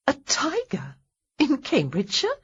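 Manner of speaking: high, disbelieving question; incredulous. Acted. Heroine teasing him, incredulous. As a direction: she can't believe what she's hearing.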